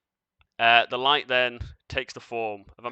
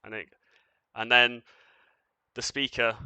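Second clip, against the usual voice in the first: ordinary talk, nothing marked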